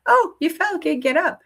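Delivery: high, breezy matter-of-fact reassurance; calm parent. Retold or imitated. Parent calmly telling the toddler to get up, breezy. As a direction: lightly brush off the fall. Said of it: "versus a parent who says".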